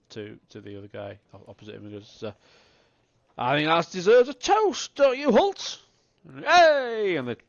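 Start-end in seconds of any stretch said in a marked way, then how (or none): 3.4-5.8 loud, higher boozy cheer; drunken toast. Acted. Drunk man proposes a toast. 6.4-7.2 loud high whoop; drunken cheer. Acted. Drunks clink tankards.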